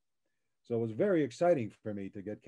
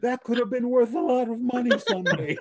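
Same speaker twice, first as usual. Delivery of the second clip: high, louder mock panic with a laugh; much higher and louder, laughing fretful mom; mother freaking out; 12 semitones higher, 6 dB louder than usual. Mimicking his daughter fretting over the toy box, high mock panic. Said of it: "his mother is freaking out".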